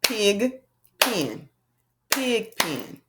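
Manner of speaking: "Pig pen" beat out in two even claps; clapping syllables. Shown as a demonstration. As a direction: clap out "pig" and "pen" separately. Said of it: "let's clap out pig pen".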